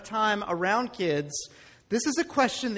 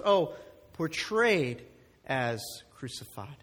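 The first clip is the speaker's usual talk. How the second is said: slow, lower "Oh, portrayed" as a discovery; realizing emphasis; 7 semitones lower, 3 dB quieter, slower than usual. Shown as a demonstration. Pastor pointing the church to the wording, slow emphasis. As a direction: lean on "portrayed" slowly. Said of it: "look at the wording clearly".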